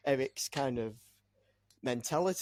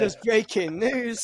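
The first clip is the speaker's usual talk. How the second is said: slow, loud, higher-pitched newsflash; mock news announcer; 6 semitones higher, 8 dB louder, slower than usual. Podcast guest announcing his joke transfer, mock news announcer. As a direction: proclaim it like a spoof bulletin.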